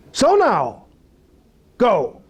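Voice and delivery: high, firm order, each part set apart; God's command. Acted. Acts out God commanding Moses.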